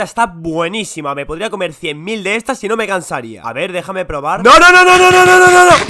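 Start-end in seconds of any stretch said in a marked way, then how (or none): 4.4-5.8 rapid loud high nos; frantic shouting. Acted. Protecting the last cookie, frantic nos. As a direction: yell to stop him. Stop him taking cookie.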